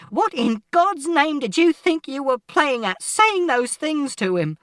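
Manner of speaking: very high, loud, slow outrage; furious shout. A narrator's reading. Mother accusing father over their son, shouting furiously. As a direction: shout each phrase out, high and drawn out. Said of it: "And Mother shouted".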